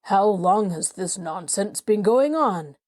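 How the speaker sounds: a father's stern, displeased question; angry. A narrator's reading. Angry father questioning Polly about the bouquet, stern. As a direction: demand it sharply, like an angry father. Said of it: "as he asked angrily".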